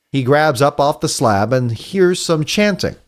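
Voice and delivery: usual speaking voice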